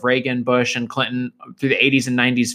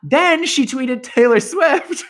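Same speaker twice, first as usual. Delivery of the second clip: high, drawn-out announcement; gleeful reveal; 12 semitones higher, slower than usual. Real, not acted. Telling a co-host who she tweeted next, gleeful reveal. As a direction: stretch it out in a raised, excited pitch.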